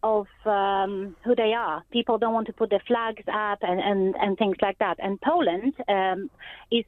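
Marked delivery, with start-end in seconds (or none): none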